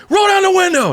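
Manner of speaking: very high mock-angry bark; hammed-up irate command; imitating furious guard. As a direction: mimic a guard at the end of his rope. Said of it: "he would get more mad".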